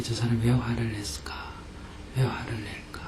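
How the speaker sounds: hushed, softly trailing self-question; musing to oneself. Shown as a demonstration. Teacher shows student how to ponder anger, musing softly. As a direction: ponder it almost under your breath.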